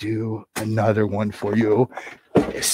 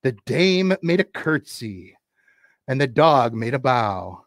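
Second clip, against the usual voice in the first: usual speaking voice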